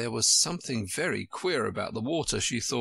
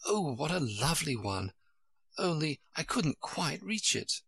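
put-on wistful little girl, talking to herself; pitched-up admiration, then mild frustration; child Alice; 4 semitones higher than usual. A narrator's reading. Alice admires a rush she cannot reach, wistful child voice.

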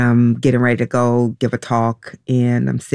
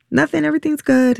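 high, fast, falsely cheerful reassurance; feigned normal voice; 13 semitones higher, faster than usual. Retold or imitated. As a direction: pretend all is fine to a child, pitched way up. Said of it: "trying to make my voice sound normal".

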